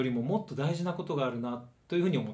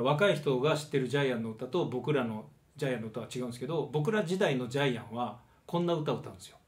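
normal talking voice

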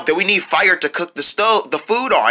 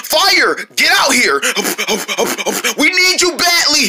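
high, drawn-out plea; urgent, comic begging; desperate calling; 7 semitones higher, slower than usual. Acted. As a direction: beg the fire to appear, pitched way up.

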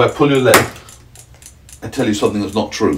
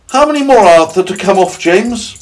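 high, loud centipede question; demanding centipede; 13 semitones higher, 7 dB louder than usual. Acted. Centipede asking James about his boots, high and demanding. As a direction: press for an answer, high and loud.

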